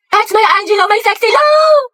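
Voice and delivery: high, rising swoony cheer; giddy sing-out to a crush; adoring fan cheer. Acted. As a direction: cheer for your crush, pitch climbing.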